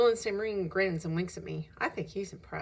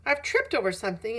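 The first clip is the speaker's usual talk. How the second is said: ordinary delivery, nothing marked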